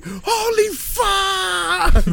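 high, very slow drawn-out scream; terrified yell. Retold or imitated. Yelling on the ride so people heard, long high scream. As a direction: wail it as the ride drops. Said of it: "people heard me".

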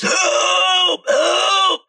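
high desperate screams for help; distant screams. Acted. Game master voicing a trapped man screaming from the woods. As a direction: cry out high as if from the forest. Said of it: "The screams from the forest".

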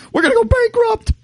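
high, fast panicked store clerk. Pretend. Mocking the gas station staff, high panic.

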